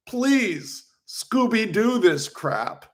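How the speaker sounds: put-on a lightly done, terrible American accent, slowed on "Scooby-Doo this crap"; mock Doctor Strange's clumsy American accent, slow and drawn out; bad American accent. Vlogger mocking Doctor Strange's line in a bad American accent. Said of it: "in the worst American accent in history".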